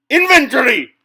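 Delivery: huge indignant yell; outraged shout. Acted. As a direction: bellow it in disgust. French knight outraged by infantry.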